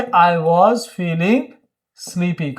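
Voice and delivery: each word stretched out; slow model sentence. Shown as a demonstration. Teacher prompting students to answer, very slow and drawn out. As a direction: spell it out word by word for learners. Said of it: "तो क्या बोलेंगे?".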